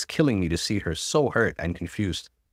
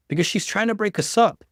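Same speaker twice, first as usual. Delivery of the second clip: much higher, urgent exclamation; a pitched-up urgent cry; exclaiming; 11 semitones higher than usual. A narrator's reading. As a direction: blurt it out desperate to be believed. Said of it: "I exclaimed".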